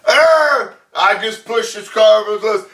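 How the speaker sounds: high, loud, hammed-up dinosaur roar into words; roaring T-Rex. Host voices the T-Rex after the car goes over, loud mock roar. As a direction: play the T-Rex bellowing and proud.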